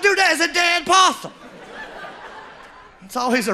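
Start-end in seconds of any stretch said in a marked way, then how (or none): 0.0-1.3 disbelief. Retold or imitated. Disappointed fairgoer seeing the rat.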